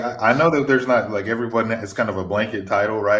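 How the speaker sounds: ordinary talk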